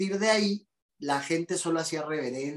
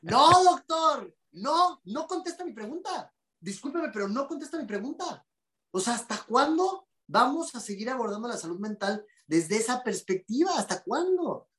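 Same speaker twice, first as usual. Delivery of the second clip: higher, shouting at the doctor without a mic; retold shout; 6 semitones higher than usual. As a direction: call out across the room, high and insistent. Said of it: "Y yo grité así sin micrófono".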